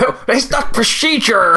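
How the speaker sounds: slow, lightly done bad American accent; a hint of a clumsy movie American accent; quoting Jason Statham's awful American accent. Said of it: "the worst American accent ever".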